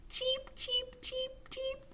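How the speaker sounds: very high little chirps; high, evenly spaced cheeps; cheeping baby chicks. Pretend. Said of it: "baby chicks say".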